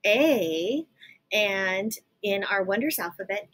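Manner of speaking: ordinary talk, nothing marked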